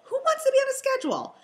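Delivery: fast, high mocking question; high scoff. Real, not acted. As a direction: toss it off incredulously. Rhetorical scoff.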